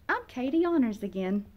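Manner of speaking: plain reading voice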